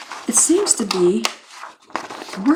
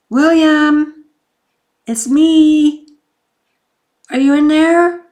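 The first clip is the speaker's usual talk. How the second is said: high, loud call through a closed door; 9 semitones higher, 11 dB louder, slower than usual. Retold or imitated. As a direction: call out to him from the porch.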